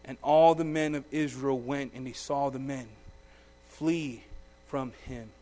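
measured Bible reading, falling to a hush; solemn scripture reading. Acted. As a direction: read the passage like a preacher at the lectern. Reads scripture aloud.

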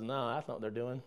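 low, hushed dismissal; calm correction. Acted. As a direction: answer the panic softly and low, as calm Moses. Acts Moses calmly correcting Joshua.

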